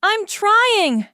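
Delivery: very high, loud stressed shout. A narrator's reading. Rami shouting back to captain under fire, loud. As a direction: yell back under pressure. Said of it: "Rami shouted".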